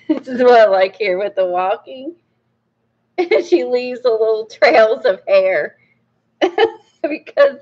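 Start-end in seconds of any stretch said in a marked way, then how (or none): 3.2-7.6 real laughter breaking into the words; laughing. Real, not acted. Book reviewer tickled by the drawn trails of hair, laughing. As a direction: giggle through it, amused.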